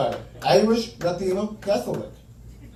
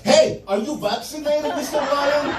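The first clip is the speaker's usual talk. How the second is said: nervous patient; 5 semitones higher than usual. Retold or imitated. Mock scared question to doctor.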